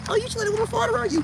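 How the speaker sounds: squeaky high mocking voice; mock falsetto. Mocking a weak man.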